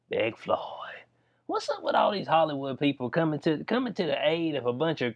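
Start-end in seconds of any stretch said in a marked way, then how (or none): 0.1-0.6 "Big Floyd" breathed as a whisper. Shown as a demonstration. Vlogger repeats the name to his viewers, whispering. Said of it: "Oh, Big Floyd".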